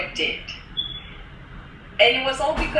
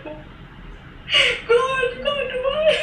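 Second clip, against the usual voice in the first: much higher, tearful cry of joy; crying out to God; slower than usual. Real, not acted. Student hearing of the job and pay, tearful high cry to God. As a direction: cry out in overwhelmed disbelief. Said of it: "Oh, I started crying again".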